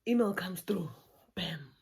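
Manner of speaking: whispered, building suspense; dramatic whisper. Real, not acted. Telling viewers the big email arrived, suspense whisper. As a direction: whisper it like a reveal.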